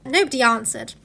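plain reading voice

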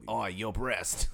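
very slow mock Australian "your breast"; Australian accent. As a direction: drawl "breast" the Aussie way. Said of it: "No, that's Australian".

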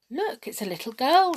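high, loud exclamation of amazement; astonished. A narrator's reading. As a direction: cry it out in great surprise. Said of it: "in the greatest astonishment".

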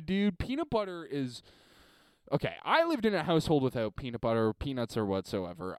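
usual speaking voice

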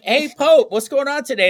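high, lilting hello; chirpy mock greeting. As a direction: play the friend calling over brightly. Acting out a friend's greeting.

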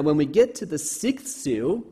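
normal talking voice

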